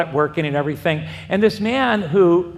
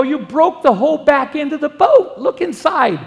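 raised, higher voice, scolding a friend who wrecked his boat; exasperated shout; 8 semitones higher than usual. Retold or imitated. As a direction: let it burst out in disbelief at the damage. Angry retort to boat buyer.

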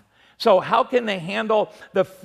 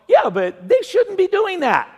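high, whining mock protest, a bit louder; whiny protest; 11 semitones higher, 4 dB louder than usual. Young driver whining back at the officer, high and petulant. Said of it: "And I go".

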